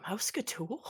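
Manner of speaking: soft, tentative questioning; quiet stunned father; hushed recognition. Acted. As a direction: ask it softly, hardly believing it. Father recognizes his son.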